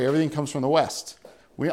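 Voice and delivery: ordinary delivery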